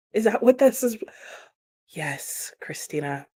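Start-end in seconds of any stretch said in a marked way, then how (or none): 1.9-3.3 whisper. Real, not acted. Secret agreement with a viewer.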